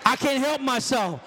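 ordinary talk, nothing marked

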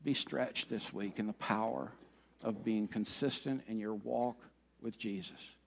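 soft, low pastoral appeal; heartfelt sermon close. Acted. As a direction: bring it right down, quiet and sincere. Preacher's gentle, earnest closing appeal.

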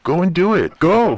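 playful retold goading; egging on.

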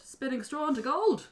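high incredulous question; king voicing sudden disbelief; astonished king. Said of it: "king thought".

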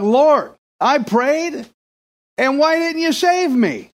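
complaining man, voice pitched high; indignant complaint, high. Retold or imitated. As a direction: whine to God that you prayed. Dead man complains to God.

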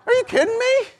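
squealed very high, laughing through it; a laughing shriek of disbelief. Retold or imitated. Pastor mocking his own reaction to yet another request, laughing squeal. Third stranger asks for help.

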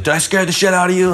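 high, fast taunt; mocking cop taunt, fast and high. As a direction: needle him cheerfully. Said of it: "he's like".

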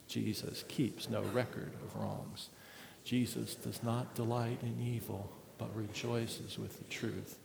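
soft, low scripture recitation with own name; quiet responsive reading. Acted. Pastor leads the church reading the verses with his own name, quietly. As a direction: read it hushed, as a confession.

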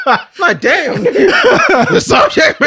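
high, loud cackling exclamation; laughing outburst. Real, not acted. Friend teases co-host over asking about subject matter, laughing outburst. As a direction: holler it through helpless laughter. Cracks up at co-host's question.

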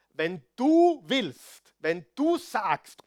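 "du" stretched high and loud; slow, drawn-out weight on each "du"; emphasis on DU. Acted. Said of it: "Er hat gesagt". (das "du" langgezogen und laut betont)

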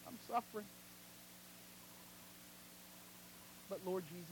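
very soft, halting plea to God; hushed prayer. Acted. Preacher voicing a sufferer's prayer to Lord Jesus, hushed. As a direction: pray it quietly with long pauses.

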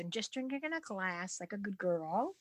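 ordinary talk

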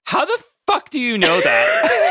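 annoyed. Retold or imitated. Sue challenges Magneto's claim, annoyed and incredulous. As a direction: stretch it out in bitchy disbelief. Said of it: "so annoyed by everything and kind of bitchy".

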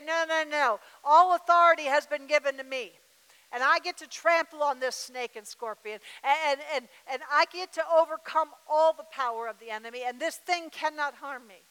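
loud, forceful rebuke of the enemy; bold declaration. Shown as a demonstration. Preacher showing the church how to rebuke the enemy, loud and forceful. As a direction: proclaim it like you mean it.

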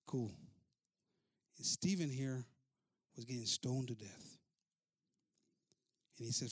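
normal talking voice

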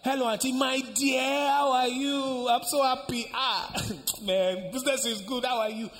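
high, loud gushing hello to family; delighted phone greeting. Acted. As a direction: greet your favourite relative, overjoyed. Auntie delightedly greets niece on phone.

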